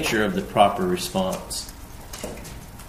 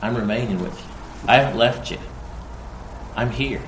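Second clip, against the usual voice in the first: soft reassurance; 7 dB quieter, faster than usual. Acted. Tender quote of God's promise.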